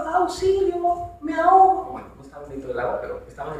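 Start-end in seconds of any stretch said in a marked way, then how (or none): 0.0-1.9 high mock cry of "¡Auxilio! ¡Me ahogo!"; hammed-up cry for help; crowd crying for help. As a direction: play a panicked crowd calling for help, pitched high. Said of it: "el pueblo estaba gritando".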